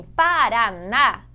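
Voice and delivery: "Paraná" with a strong final "ná"; stress on last syllable. Shown as a demonstration. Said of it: "a sílaba mais forte em Paraná". ("Paraná" com a última sílaba bem forte)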